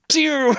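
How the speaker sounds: high, loud zippy pew of a rod casting; casting noise. Retold or imitated. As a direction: zap the line out like a laser. Imitates casting a fishing rod.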